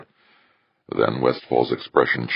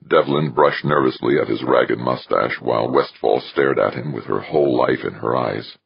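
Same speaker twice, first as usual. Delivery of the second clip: usual speaking voice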